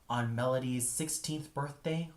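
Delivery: ordinary delivery, nothing marked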